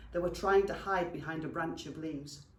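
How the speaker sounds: usual speaking voice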